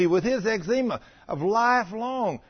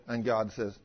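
ordinary talk

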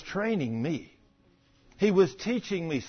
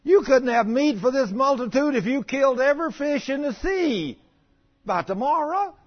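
much higher, louder protesting plea; exasperated Moses; 8 semitones higher, 5 dB louder than usual. Acted. Moses protests to God about the meat: high, loud disbelief. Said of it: "Moses said".

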